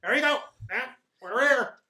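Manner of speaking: high chirpy driver announcing arrival; cheerful little driver, unhurried; gnome driver voice. Acted.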